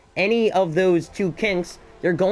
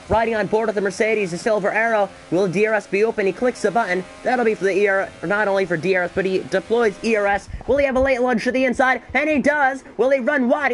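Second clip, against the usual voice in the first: building race call, higher and faster into the lunge; excited play-by-play; 5 semitones higher than usual. Real, not acted. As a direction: call the overtake as it happens. Live race play-by-play surge.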